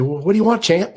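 high, eager helpers; entourage voice. Retold or imitated. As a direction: ask it high and keen. Entourage asks Ali.

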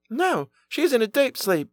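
put-on high, thin old woman's voice; old nurse. A narrator's reading. Old nurse answers again.

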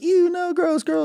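put-on squealing high kid voice, grossed out; disgusted little kid. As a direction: act a kid who thinks girls have cooties. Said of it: "You're like".

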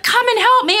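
high-pitched insistent call; imitated frantic plea. Partner insists she get up, frantic plea. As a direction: mimic a desperate call for help. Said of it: "he's like".

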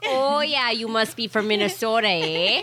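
playful put-on Minnesota accent. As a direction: imitate a Minnesotan, ending on a tag "eh". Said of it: "on my Minnesota accent".

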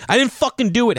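high, fast indignant denial; hammed-up defiant protest; mock suspect. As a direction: play the wrongly accused, whining. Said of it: "Saying that all the time".

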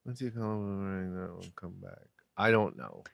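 very soft, low, quick repeat; musing murmur. Real, not acted. [name] puzzling over Rob's riddle, murmuring. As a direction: murmur it to yourself while thinking.

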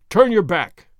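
loud, stern order; loud command. A narrator's reading. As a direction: order him round with force. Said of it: "he commanded".

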